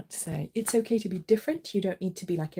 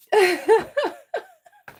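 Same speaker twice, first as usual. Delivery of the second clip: delighted high laugh; 11 dB louder than usual. Real, not acted.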